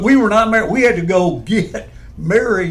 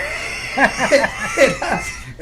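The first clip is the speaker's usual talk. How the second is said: burst of laughter; 6 semitones higher, 4 dB quieter than usual. Real, not acted. Laughing at own story.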